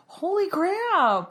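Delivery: high, slow, drawn-out holy crap; stunned. Real, not acted. Friend reacting to an awful story, slow and high. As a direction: drag it out in disbelief. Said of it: "reacting to story".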